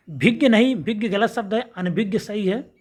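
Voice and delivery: ordinary talk, nothing marked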